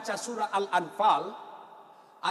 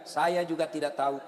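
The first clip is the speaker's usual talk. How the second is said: low mock recitation; congregant answering; 8 semitones lower than usual. Worshipper behind answering the stuck imam, low mock chant. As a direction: intone the admission low, playing along. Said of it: "Yang di belakang dia bilang juga".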